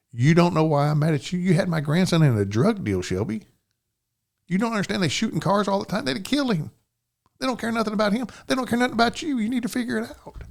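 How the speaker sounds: a father venting his fear at his daughter, voice high and urgent; retold heated scolding. Father scolding daughter over danger.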